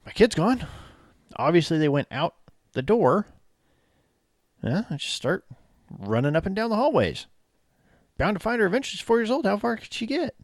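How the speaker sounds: mock his airy logic; mocking clueless dad. Co-host voices careless father.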